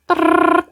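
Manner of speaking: high rolling lips trill; a quick playful brrr spin sound; whirring noise. Retold or imitated. Planet turning, sound effect. (bruit de toupie roulé)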